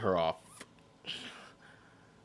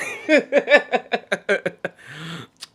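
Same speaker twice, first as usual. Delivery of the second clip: long loud burst of laughter; cracking up at her own British joke; 10 semitones higher, 6 dB louder than usual. Real, not acted.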